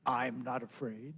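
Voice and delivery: low, slow, soft frog voice; calm deep frog answering; Brother Frog's voice. Acted. Frog reassuring the scared mouse, low and slow.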